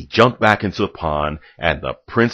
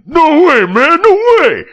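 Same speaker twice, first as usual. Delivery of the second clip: outraged wolf shout; 11 dB louder than usual. Acted. Wolf protests the narrator.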